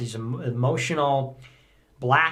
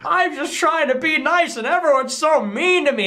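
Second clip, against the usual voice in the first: high, whiny put-upon voice; whiny pushover; 12 semitones higher, 3 dB louder than usual. Retold or imitated. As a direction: whine like a pushover who feels picked on. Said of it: "a soft who's gonna be like".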